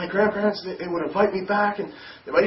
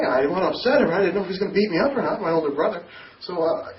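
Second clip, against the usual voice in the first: ordinary delivery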